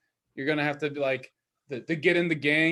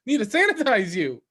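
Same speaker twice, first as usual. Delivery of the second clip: high, loud mock alarm; frantic sanitizer; 7 semitones higher, 5 dB louder than usual. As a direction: accost a stranger, hammed up. Acting out crazed sanitizer worker.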